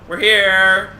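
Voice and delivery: loud, high, cheery announcement; excited arrival. Acted. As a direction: announce it like a happy tour guide. Arriving at the asylum, playful.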